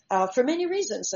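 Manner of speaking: normal talking voice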